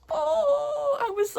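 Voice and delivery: high, teary squeal of sympathy. Retold or imitated. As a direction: let it crack with happy tears. Moved by a tearful scene.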